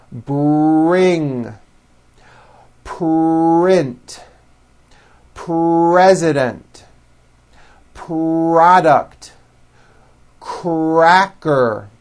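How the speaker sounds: slow words, the R held after the first consonant; strong R blends. Shown as a demonstration. Teacher gives practice words to repeat, R blends drawn out strongly. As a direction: stretch the R in "trust", "bring", "crawl" before the vowel. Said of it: "practice words with R blends".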